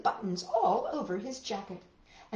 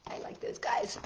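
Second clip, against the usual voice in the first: whisper; 7 dB quieter than usual. Real, not acted. Whispered aside.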